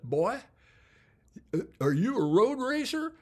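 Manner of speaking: put-on Southern accent, loud and high. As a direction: imitate a good Southern boy, surprised. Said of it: "when I imitate accents".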